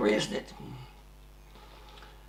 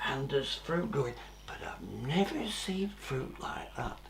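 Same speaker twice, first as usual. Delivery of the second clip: whisper; 3 semitones lower, 10 dB quieter than usual. Acted. Marvelling at strange fruit.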